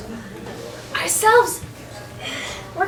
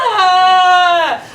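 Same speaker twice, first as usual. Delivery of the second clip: angry shout; 2 semitones lower, 3 dB louder than usual. Acted. Petruchio cries out at servant.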